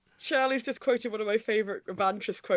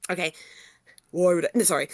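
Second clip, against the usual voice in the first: ordinary delivery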